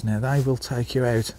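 ordinary delivery

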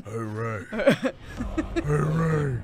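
lightly ghostly villain, trying out takes; a hint of a spooky put-on voice; Kylo Ren. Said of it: "your Kylo voice is very similar to your ghost voice".

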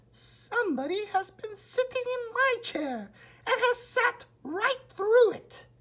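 a tiny bear wailing over his broken chair; little wee bear voice. A narrator's reading. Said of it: "cried the little wee bear in his little wee voice".

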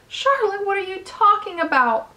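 high-pitched cry of alarm; distressed cry. A narrator's reading. Wilbur demands an explanation from Charlotte, crying out high. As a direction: question her in a high, upset voice. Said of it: "he cried".

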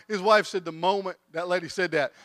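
ordinary talk, nothing marked

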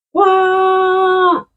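high, loud burst of delight; delighted wow. Real, not acted. Vlogger thrilled at a plant found in cotton, high excited wow. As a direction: let out a thrilled wow at the reveal.

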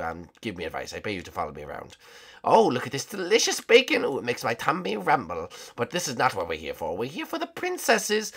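normal talking voice

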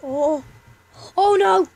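sudden alarmed cry; panicked. Real, not acted. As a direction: panic as the noise gives you away. Made noise, Granny coming.

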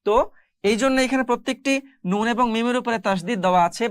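ordinary delivery

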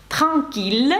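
"транкіль" with French r and sounded final l; French pronunciation. Shown as a demonstration. Teacher demonstrating exception words, French pronunciation. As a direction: say "транкіль" as French tranquille, keeping the l. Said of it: "тут звук Л".

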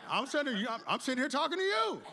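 high, comic indignation; mock exasperated protest. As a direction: protest your innocence, pitching it up. Acts protest to Jesus.

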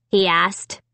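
plain reading voice

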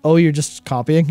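ordinary talk

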